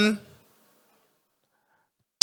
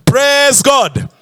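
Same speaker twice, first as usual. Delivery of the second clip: higher, insistent praise call; prompting the congregation, pitch raised; rallying call; 4 semitones higher than usual. Real, not acted. Repeats it to draw congregation response.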